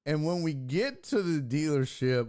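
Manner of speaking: ordinary talk, nothing marked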